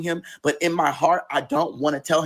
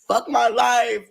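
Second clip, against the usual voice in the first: "Fuck my life" higher and louder, exasperated; exasperated cry; 7 semitones higher, 3 dB louder, much slower than usual. Retold or imitated. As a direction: throw "Fuck my life" up, fed up. Repeated louder.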